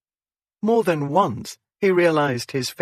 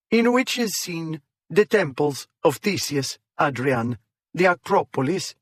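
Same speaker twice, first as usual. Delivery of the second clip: put-on a light Greek accent on the catalogue text; mildly put-on Greek accent; exaggerated Greek accent; 2 semitones higher than usual. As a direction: read it like a hammed-up Greek guide. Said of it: "In an exaggerated Greek accent".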